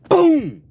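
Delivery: loud, high imitated boom; loud mouth sound for a knockout hit; punch sound effect. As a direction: burst out a punch noise. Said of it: "John hits him again".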